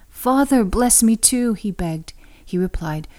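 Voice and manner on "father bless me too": loud, high, pleading cry; loud bitter sobbing. A narrator's reading. Esau begging his father for a blessing, crying loudly. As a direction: beg through tears, voice raised high. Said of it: "he burst into loud, bitter sobbing".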